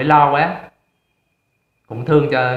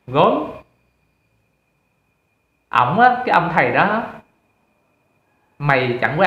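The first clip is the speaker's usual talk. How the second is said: scolding friend. Acted. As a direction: lay into a friend with contemptuous reproach.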